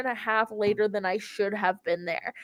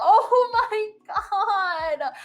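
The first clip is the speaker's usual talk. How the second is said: laughing; 10 semitones higher, much slower than usual. Real, not acted. Reacts to him showering for date.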